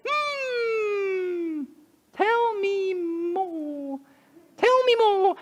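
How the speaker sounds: high, slow, drawn-out musing bunny; curious bunny. Acted. Fickle the bunny wondering aloud to Christopher, high and slow. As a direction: hum it thoughtfully, very curious. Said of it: "But Fickle said".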